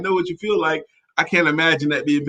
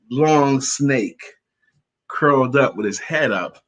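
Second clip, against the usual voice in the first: normal talking voice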